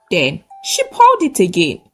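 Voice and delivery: ordinary delivery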